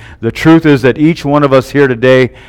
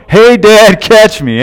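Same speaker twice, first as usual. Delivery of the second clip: put-on higher, louder boy's call; child yelling; 8 semitones higher, 4 dB louder, slower than usual. Son calling to dad from the rocks, a light mock yell. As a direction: call out gleefully like a jumping kid. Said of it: "a voice from above me yell".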